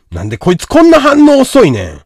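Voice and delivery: loud, high-pitched irritated complaint; high, loud Kansai-dialect scolding; exasperated outburst. Real, not acted. Berating a slow-replying viewer.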